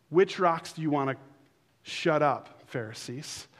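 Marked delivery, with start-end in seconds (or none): none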